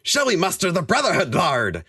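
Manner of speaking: put-on high-pitched eager accented general; dwarf general asking. A narrator's reading. General asking his lord for orders, eager question. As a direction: ask it high, falling away on the title. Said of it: "asked Dorak Ironhead".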